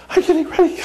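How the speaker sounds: mock-frantic high squeal; frantic self. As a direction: squeak it out like a panicked husband. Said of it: "And I was like".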